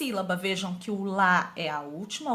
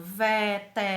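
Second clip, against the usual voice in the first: syllable by syllable; 2 semitones lower than usual. Shown as a demonstration. Teacher counting four syllables, syllable by syllable.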